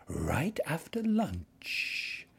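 whispering snake. A narrator's reading.

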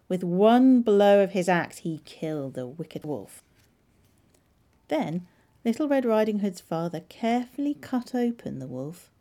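ordinary delivery, nothing marked

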